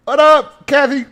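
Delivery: very high, loud whoop; hollered greeting. Real, not acted. Host interrupts his story to greet an arrival, high loud holler. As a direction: break off and holler hello.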